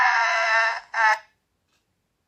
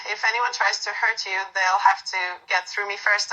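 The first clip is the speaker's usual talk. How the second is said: ordinary delivery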